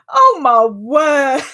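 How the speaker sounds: loud, drawn-out astonishment; real shock, stretched out loud; shocked exclamation. Real, not acted. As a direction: exclaim slowly, scandalised and amused. Shocked by a chat answer.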